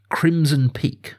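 ordinary talk

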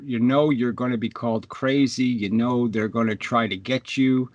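ordinary talk, nothing marked

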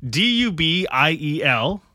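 slow letter-by-letter spelling. Real, not acted. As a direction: spell each letter slowly. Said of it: "Say it, say it again".

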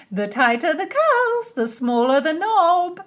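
high, slow princess voice; a lilting royal lady. A narrator's reading. Narrator voices Cecilia.

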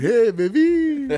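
slow, drawn-out flirty greeting. Pretend. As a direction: stretch it out like a smooth pickup line. Flirty greeting repeated slowly.

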